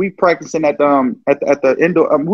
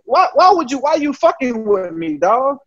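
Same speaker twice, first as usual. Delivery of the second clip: high, incredulous retold outburst; indignant outburst; 7 semitones higher, slower than usual. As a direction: act out disbelief at the coach, pitched up. Retold reaction to coach's taunt.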